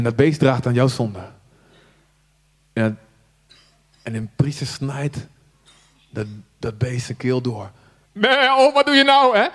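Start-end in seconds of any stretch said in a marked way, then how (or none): 2.7-7.7 hushed storytelling. A narrator's reading. Grim sacrifice scene told hushed. 8.1-9.5 high, loud bleating lamb voice, shocked. Acted. Preacher voices the slaughtered lamb, high bleating protest.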